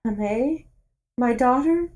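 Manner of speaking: loud, high, overjoyed mother; emotional mother. Acted. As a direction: cry out to a lost child. Mother greets lost daughter.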